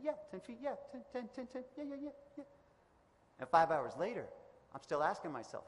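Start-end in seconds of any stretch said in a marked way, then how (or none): 0.0-2.4 softer and softer, higher, trailing off; fading exhausted mumble. Retold or imitated. Freezing skier answers himself, trailing off into a weary mumble. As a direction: mumbles yes to himself, worn out.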